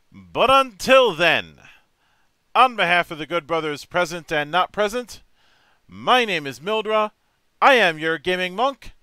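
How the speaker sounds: loud, slow, high sign-off; announcer outro. Acted. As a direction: boom out the show's closing lines.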